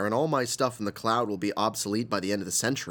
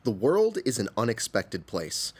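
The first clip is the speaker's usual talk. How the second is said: normal talking voice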